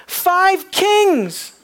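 Five kings declared very high and loud, falling hard on kings; ringing Five kings, stress on kings; triumphant proclamation. Real, not acted. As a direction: announce Five kings like a victory shout. Climactic declaration of victory.

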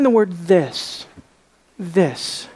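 "this" set apart with a falling tune; stress on "this". Shown as a demonstration. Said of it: "underline this word".

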